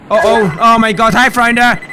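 usual speaking voice

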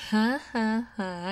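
slow, spelled-out mock laughter. Coworker scoffing at his massage offer, mocking laugh. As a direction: laugh off his silly idea.

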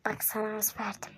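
soft unvoiced whisper. Real, not acted. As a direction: confide it in a whisper. Secret aside.

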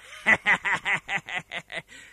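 mocking laugh in a changed voice; villain laugh. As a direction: laugh at the cornered enemy. Villain laughs at cornered hero.

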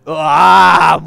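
loud, slow drawn-out wail; loud groan. Real, not acted. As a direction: groan at the ominous silence. Music stops ominously.

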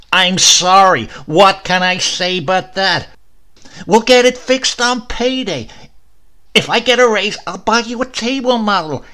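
loud, high exasperated yelling; angry shout. A narrator's reading. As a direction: shout every line at her, cornered and furious. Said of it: "he shouted".

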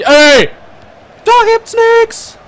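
high, loud angry yell; angry shout. Real, not acted. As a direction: yell in real annoyance. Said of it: "Ey, ich werd richtig sauer".

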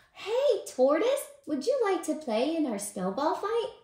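very high, loud, eager call; excited Squirrel. A narrator's reading. Squirrel calling Tortoise to a snowball fight, excited. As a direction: shout the invite in a perky high voice.

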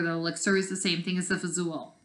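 plain reading voice

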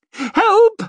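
very high, loud shriek for help. A narrator's reading. Maid cornered by a mob in the carriage, shrieking. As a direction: shriek in panic to be rescued. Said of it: "she shrieked".

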